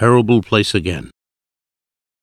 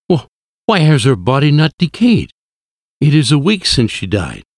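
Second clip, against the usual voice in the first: baffled and shaken, pitch raised; shocked disbelief; 5 semitones higher than usual. A narrator's reading. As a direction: stammer into a higher, stunned question. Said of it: "I gasped".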